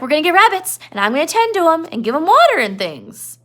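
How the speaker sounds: put-on a big kid whining innocently; Lenny. Teacher voicing Lenny explaining why he came, high and childlike. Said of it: "Lenny's like".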